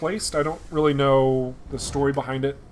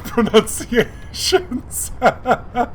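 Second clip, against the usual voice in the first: higher, louder, breaking into laughter; laughing; 9 semitones higher, 6 dB louder, much slower than usual. Real, not acted. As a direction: laugh through the word, amused at yourself. Laughs at own trouble with names.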